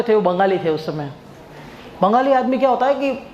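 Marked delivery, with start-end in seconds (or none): none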